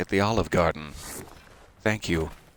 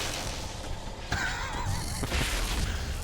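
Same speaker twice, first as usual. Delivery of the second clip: villain laugh; 8 dB quieter than usual. Retold or imitated. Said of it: "and then he's like".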